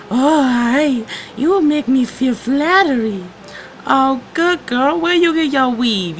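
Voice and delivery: high squeaky cartoon voice; high silly voice. Skit bird and bee voices.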